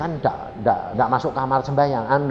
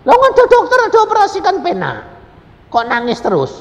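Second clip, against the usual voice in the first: much higher, loud puzzled voice; loud high astonished questioning; high mock incredulous woman; 10 dB louder than usual. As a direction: ask in disbelief why she cries. Voicing church member asking.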